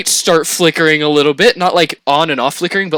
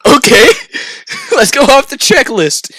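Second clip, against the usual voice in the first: high and loud, laughing as he talks; laughing through it; 10 semitones higher, 6 dB louder than usual. Retold or imitated. To friends, laughing while quoting his own panic. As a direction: giggle out the checklist. Joking about the Bible on board.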